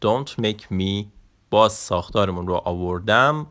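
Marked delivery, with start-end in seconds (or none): 0.0-1.0 very slow "Don't make me"; slow teaching demo. Shown as a demonstration. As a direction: stretch each word out for learners. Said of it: "خب، نگاه کن". (خیلی آهسته و کشیده)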